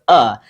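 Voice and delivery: a short, unstressed "uh"; lazy schwa sound. Shown as a demonstration. Teacher contrasting it with long A, lazy "uh". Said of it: "that schwa sound or that lazy sound".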